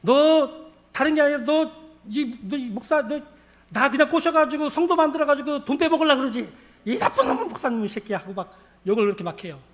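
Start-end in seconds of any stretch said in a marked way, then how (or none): 0.0-8.2 raised, accusing scolding; half-amused mock drunken rant; imitated cursing. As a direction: accuse him, stumbling over the words. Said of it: "한 시간을 그렇게 막 욕을 하면서".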